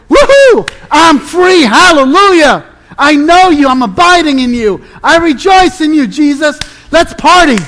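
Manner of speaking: loud, high-pitched whooping celebration; hammed-up party cheer, loud and exultant; mock jubilation. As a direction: act out an over-the-top overjoyed believer. Imagines an overjoyed response to Jesus.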